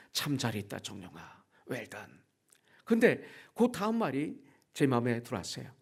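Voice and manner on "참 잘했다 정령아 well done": very soft, low praise; tender commendation. Retold or imitated. The Lord welcoming his servant, hushed low praise. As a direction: murmur it low, as a tender blessing.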